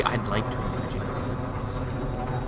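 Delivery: slow, creepy whispered line. Shown as a demonstration. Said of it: "I know, it was the".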